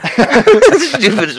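slow, high laughing words. Real, not acted. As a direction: dissolve into laughter mid-word. Laughing breaks the scold.

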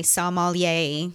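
slow "sommelier" ending like A-OK; rhymes with A-OK. Shown as a demonstration. Co-host teaching the word like saying A-OK, slow demonstration. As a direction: draw out "sommelier" with a rising YAY. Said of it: "It's kinda like saying A-OK".

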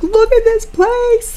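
high, slow, wailing in hammed-up despair; mock crying wail. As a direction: cry out at the mess like a wounded parent. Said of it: "And I'm like".

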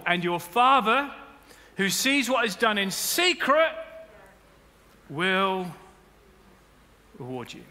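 solemn scripture reading. Acted.

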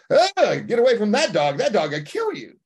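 high, loud panicked warning, imitated; mock alarm. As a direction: cry out as if imitating a frightened bystander. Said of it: "people naturally go".

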